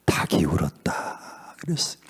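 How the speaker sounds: low, near-whispered answer; hushed solemnity. Real, not acted. Preacher answering what cried after Peter's denial, hushed. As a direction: let it fall away to a hush.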